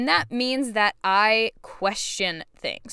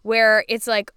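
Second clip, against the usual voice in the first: usual speaking voice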